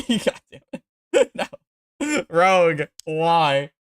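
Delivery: high laughing disbelief; laughing outrage. Real, not acted. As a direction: laugh through the curse, slowly. Laughing after dying to rogue.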